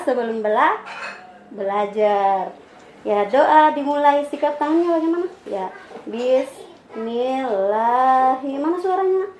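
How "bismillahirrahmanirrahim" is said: put-on drawn-out syllables for a child to follow; slow prayer recitation. Shown as a demonstration. Teacher leading child in prayer before study, slow recitation.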